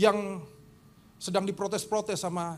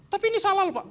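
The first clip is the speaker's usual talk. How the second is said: high, louder objection; protesting leader; 13 semitones higher, 4 dB louder, faster than usual. Retold or imitated. Leader telling the pastor he is wrong, high. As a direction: insist he's wrong.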